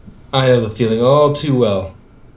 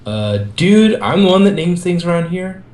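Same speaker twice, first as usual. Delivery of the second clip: louder, higher indignant protest; 8 semitones higher, 4 dB louder than usual. Acted. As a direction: push back like an offended teen. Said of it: "Michelangelo countered".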